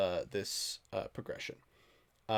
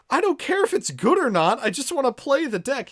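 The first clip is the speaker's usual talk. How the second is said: high, loud mock outrage; indignant protest; 11 semitones higher, 8 dB louder than usual. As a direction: protest loudly at a friend's verdict. Acting out his protest to friend.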